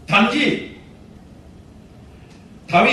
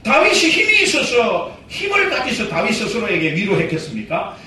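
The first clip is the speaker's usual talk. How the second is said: ordinary talk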